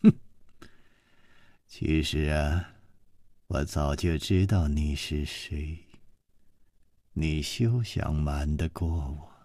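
low, soft, contemptuous; a scornful hushed taunt; cold sneer. Acted. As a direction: sneer quietly, letting him know she sees through him. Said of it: "只听这个女子冷笑着说".

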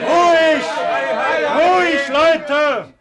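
loud, high commanding call; calling for calm. Acted. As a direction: shout the crowd down to order. Egmont calls out to stop fight. (lauter, hoher Ruf nach Ruhe)

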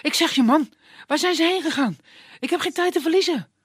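high, loud and fast hurried youth; impatient young man. Acted. Dimitri pressing the woodsman for directions, high hurried voice. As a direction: rush him, no time to lose.

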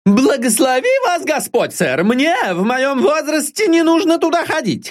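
put-on high, sly old woman's chatter; a cheeky servant rattling on, then a frightened cry. Old servant Deborah prattles on to her angry master.

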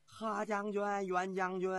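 high, sustained calls to the generals; calling out. Acted. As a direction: call for help from afar. Fleeing soldiers call out to generals. (高声拖长呼喊)

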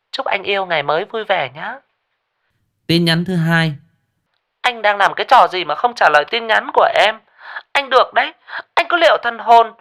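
shrill out the threatening texts; jealous girlfriend voice. Acted. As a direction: girlfriend threatening via text.

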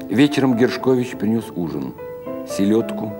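ordinary delivery, nothing marked

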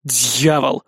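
low, understated swearing; muted curse. A narrator's reading. Hero hearing who sent the alarm, cursing. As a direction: curse under the narration, pitch dropped. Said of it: "выругался я".

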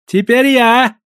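high, loud exclamation; exclaiming. A narrator's reading. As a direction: burst in to claim the turn. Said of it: "воскликнул Гао-председатель".

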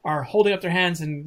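ordinary talk, nothing marked

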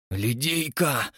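slow hoarse gasp. Acted. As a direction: croak the name in shock. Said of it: "хрипло произнёс".

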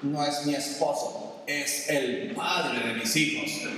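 low, slow, bitter sneer; bitter scorned woman. Retold or imitated. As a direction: drag out the contempt for the ex. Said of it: "empiezas a hablar así".

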